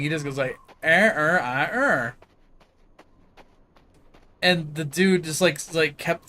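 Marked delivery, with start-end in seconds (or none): 0.8-2.1 "Er, er, uh, er" as repeated r-heavy grunts, first ones punched; accent-swallowed vowels. Retold or imitated. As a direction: imitate a buddy reducing the phrase to "Er, er, uh, er". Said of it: "his buddy did the same thing".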